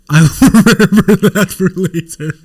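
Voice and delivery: laughing mock threat. Real, not acted. Streamer replying to chat, laughing.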